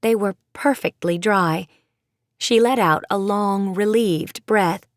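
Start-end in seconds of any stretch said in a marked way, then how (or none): none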